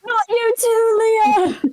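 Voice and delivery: laughing shout. Real, not acted. Party breaks into laughter.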